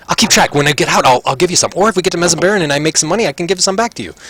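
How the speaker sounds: high, excited betting voice; rushing to lay a bet on the fight; eager gambler. Acted. Prisoner placing bets on the orc fight, excited and high.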